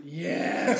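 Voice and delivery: loud gleeful laughing yes; excited yes. Retold or imitated. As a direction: cheer it out with a laugh. Gleeful shout at jury summons.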